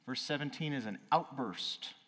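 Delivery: ordinary delivery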